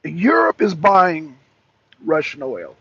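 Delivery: spelling it out slowly, word by word; slow it right down so the caller gets it; slow and deliberate. Real, not acted. Host repeating slowly to a confused caller, deliberate.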